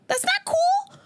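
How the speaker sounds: "That's not cool" squeaked very high; mock indignant squeak. Comedian mocks snakes kept in a sunglass case, squeaky. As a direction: whine it in a squeaky falsetto.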